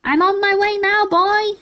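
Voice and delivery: high, loud crone call; grandma calling down to George as she rises. A narrator's reading. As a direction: call down gleefully from above. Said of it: "she called down to George".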